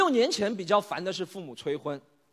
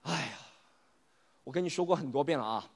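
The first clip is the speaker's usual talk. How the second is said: quieter, lower sigh of patience; tired of repeating a correction; weary friend; 4 semitones lower, 3 dB quieter than usual. Acted. (疲惫无奈地纠正)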